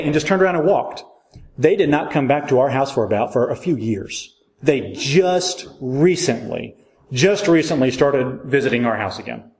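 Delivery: ordinary talk, nothing marked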